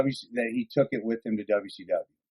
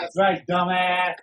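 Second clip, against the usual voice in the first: stretched-out, higher-pitched echo; milk the taunt slowly and playfully; mock catchphrase taunt; 6 semitones higher, slower than usual. Echoing the drawn-out catchphrase.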